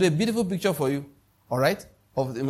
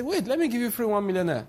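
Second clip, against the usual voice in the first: higher-pitched, mock-eager offer; mock generous offer; 7 semitones higher than usual. Somebody offering a non-gambler free naira, mock generous. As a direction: pitch it up like a sudden giveaway. Acts out an unlikely generous giver.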